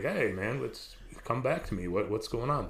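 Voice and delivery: ordinary delivery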